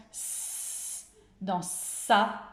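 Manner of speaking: "s" as a long hiss, then "sa" with a held s; isolated sound. Shown as a demonstration. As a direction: hiss the "s" alone, then show it in "sa". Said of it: "on entend le son". (« s » sifflé longuement, puis « sa » étiré)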